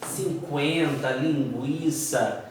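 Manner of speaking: "cinquenta" and "linguiça" slow and low, U sounded; trema words. Shown as a demonstration. Teacher listing old trema words, "cinquenta", "linguiça" with U sounded. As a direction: draw out "cinquenta" and "linguiça" with their w glides. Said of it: "palavras que tinham trema".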